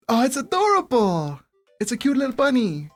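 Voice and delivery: high, delighted "aww" at cuteness; gushing cooing. Real, not acted. Player seeing a cute little bunny, high gushing coo.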